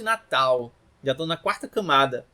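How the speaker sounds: usual speaking voice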